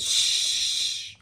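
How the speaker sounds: long, forceful voiceless shush; urgent shush. Acted. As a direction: hush a screamer with a strong "shh". Mole shushes the screaming turtle.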